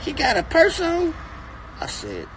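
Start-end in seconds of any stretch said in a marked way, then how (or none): 0.0-1.1 put-on high, small kid's question; child voice. Father voicing his toddler son asking about a purse, high kid voice. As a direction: ask it like a curious little boy.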